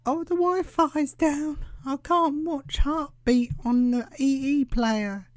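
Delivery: high, slow mock mum whining; imitating mother. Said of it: "certainly sits there and goes".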